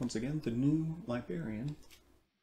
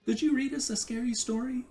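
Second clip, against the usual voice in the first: put-on eager child; 11 semitones higher, faster than usual. A narrator's reading. Dee asks for scary story.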